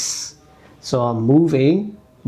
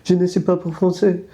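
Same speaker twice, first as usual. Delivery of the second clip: hesitant put-on French accent, pitched higher; broken French; 6 semitones higher than usual. Telling a stranger in Paris she speaks little French, in a French accent. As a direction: fumble the French like a tourist in France. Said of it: "I don't speak that much French".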